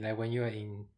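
ordinary talk

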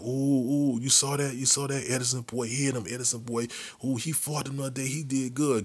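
whisper-gossip like a schoolgirl; impressed girl. Retold or imitated. Imitating an impressed girl on bus.

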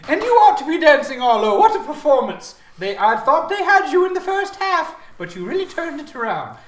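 high, loud theatrical showman; Garl. Acted. Game host praising a player's performance, loud high character voice. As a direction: gush at full volume, high and hammed-up. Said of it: "Garl says".

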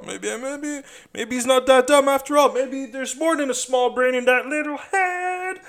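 high, mocking third-person aside, slow at the end; mock narrator voice. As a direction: needle yourself like a smug onlooker. Mocks himself in another voice.

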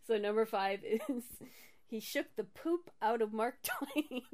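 real suppressed laughter, bursting at the end; barely holding back laughter. Real, not acted. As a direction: read it out while fighting the giggles. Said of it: "not gonna get through this without laughing".

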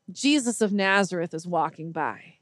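usual speaking voice

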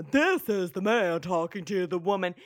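mock man voice. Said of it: "no conversation has actually sounded like".

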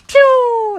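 loud, high "pew" zoom sound; whoosh noise. Acted. Storyteller imitates the pair dashing off, loud high zoom. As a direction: shoot out a high cartoon take-off noise.

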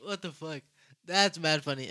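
normal talking voice

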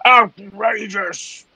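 high, drawn-out strained cry; hammed-up outburst of indignation; captain's outraged shout. The captain hears the announcement, outraged shout. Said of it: "the captain just shouts out".

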